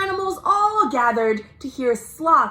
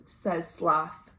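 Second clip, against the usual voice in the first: plain reading voice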